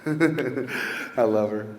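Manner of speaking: ordinary talk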